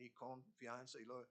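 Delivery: ordinary delivery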